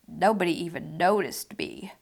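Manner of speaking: soft, unvoiced and forlorn; hushed lonely aside; whisper. A narrator's reading. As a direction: whisper it to yourself. Lonely boy in the storm.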